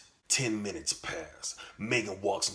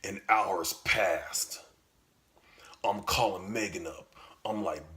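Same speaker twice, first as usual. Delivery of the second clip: ordinary talk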